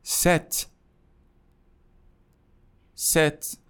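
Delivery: ست with a short e and a crisp t; ست as French sept. Shown as a demonstration. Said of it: "هفت".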